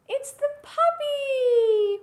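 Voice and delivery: high, loud, stretched-out announcement; exaggerated children's-presenter excitement; excited reveal. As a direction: draw out the delighted surprise. Revealing the animal at the door.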